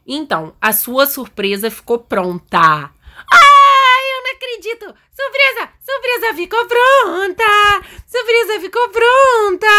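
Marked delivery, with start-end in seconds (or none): 3.3-9.7 put-on very high squealing child voice; high-pitched, hammed-up kid excitement; thrilled little daughter. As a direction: squeal with joy like an overexcited kid. Daughter thrilled that surprise is ready.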